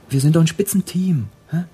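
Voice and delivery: ordinary talk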